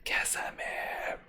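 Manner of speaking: slow whispered name. Acted. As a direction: hush it out slowly.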